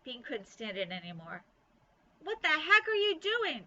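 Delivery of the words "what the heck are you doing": high, loud yell from a hidden spot. A narrator's reading. Bean yells at Ivy from inside the bush, loud and high. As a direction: Bean shouts a demand from her bush. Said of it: "she yelled".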